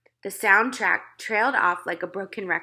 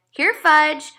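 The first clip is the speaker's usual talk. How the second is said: higher, louder calling, like for a dog; 8 semitones higher, 8 dB louder than usual. A narrator's reading. Peter calls his lost brother down the aisle. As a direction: call down the aisle. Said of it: "I called".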